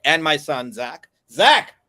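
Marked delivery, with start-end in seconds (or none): 1.3-1.7 loud call across the room. Real, not acted. Dad calls his son Zach across the room, loud call. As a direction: call your son from afar.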